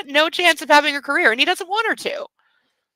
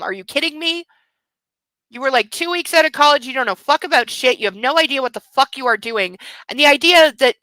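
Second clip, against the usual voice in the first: normal talking voice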